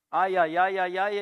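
loud, rapid string of ows; mock yelp at a burnt hand; imitated pained yelping. Preacher retelling picking up hot metal, mock pained yelping.

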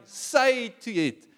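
high, loud and slow plea; crying plea. Acted. The soul crying loudly to God. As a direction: beg it out, drawn long. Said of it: "my soul is crying".

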